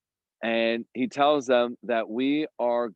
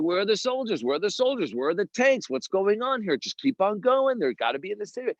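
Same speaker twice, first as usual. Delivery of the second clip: anxious urgency; 10 semitones higher than usual. Retold or imitated. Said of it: "He's like".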